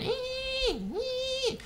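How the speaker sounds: very high squeaky motor whine; high-pitched mock engine squeal; toy truck motor whine. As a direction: imitate a little toy motor buzzing. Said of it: "and it went".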